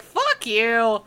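loud, defiant mock dog reply; voicing the dog. Viewer voicing the film's dog refusing orders, loud mock defiance. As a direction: speak up for a stubborn dog, drawn out. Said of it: "The dog's like".

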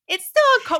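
laughing mock scold. Sister laughingly corrects Jacob about the Beetle, high-pitched. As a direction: scold him while cracking up.

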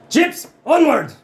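high, loud battle command; rallying cry. Acted. Rider urging Chips into the Navy, loud rallying cry. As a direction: shout it out like leading a charge.

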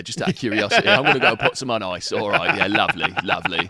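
sly Cockney menace, chummy; Cockney Bricktop impression. Podcaster ends the Bricktop bit with mock-polite Cockney menace. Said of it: "terrible, impressions of Bricktop from Snatch".

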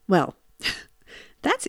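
a soft, wry chuckle; rueful laugh. Real, not acted. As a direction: laugh it off wryly.

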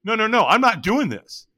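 high, loud, rushed protest; sudden panic. Retold or imitated. Firewalker suddenly sure he is burnt, loud panicked protest. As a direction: backpedal in alarm, refusing. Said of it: "all of a sudden I go".

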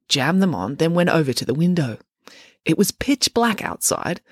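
plain reading voice

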